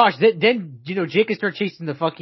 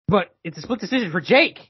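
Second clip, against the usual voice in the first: raised-pitch prophetic reveal; mock fortune teller; 6 semitones higher than usual. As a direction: announce the vision as a big, high revelation. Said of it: "Look into your fucking crystal ball".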